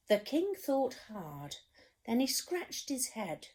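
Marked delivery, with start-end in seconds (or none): none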